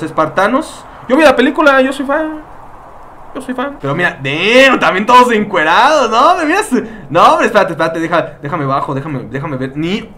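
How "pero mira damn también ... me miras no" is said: normal talking voice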